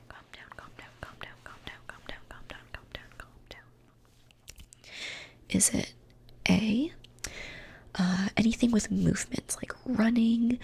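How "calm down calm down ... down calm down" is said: faint far-off layered whisper, slowing; ghostly repeated whisper in the background; echoing whisper. Acted. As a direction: whisper it as a distant echo fading away. Layered distant echo whispers.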